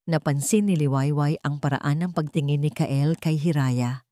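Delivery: plain reading voice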